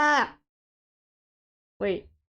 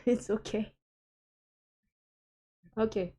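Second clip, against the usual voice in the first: usual speaking voice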